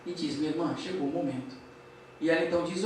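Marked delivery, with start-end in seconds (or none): 0.4-1.5 soft, low retold spirit voice; quiet, solemn call to a sister; gentle summons. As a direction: hush it and lower the pitch, gently.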